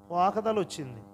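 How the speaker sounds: ordinary talk, nothing marked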